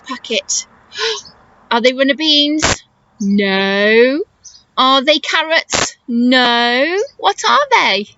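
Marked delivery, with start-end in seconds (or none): none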